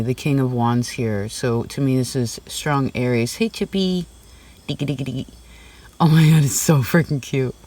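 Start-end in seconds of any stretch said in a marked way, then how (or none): 3.4-5.3 lifted, sing-song greeting to a small pet; sweet pet voice for Chippy; pet talk. Real, not acted. Cooing to the pet. 6.0-7.5 delighted gush over a cute pet; real squeal of delight at the pet. Real, not acted.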